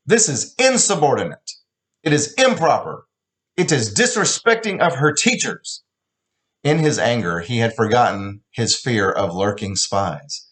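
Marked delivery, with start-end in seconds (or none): 0.1-5.8 put-on higher, rising angry accented scolding; building outrage. Father scolding daughter with growing anger. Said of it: "Each wrong he voiced was a decibel higher".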